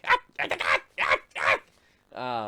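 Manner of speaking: short, loud creature yelps; kobold yelps. Acted. Kobold's robe catches divine fire, panicked creature yelps. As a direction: yelp like a little monster catching fire. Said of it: "my kobold's very similar to my goblins".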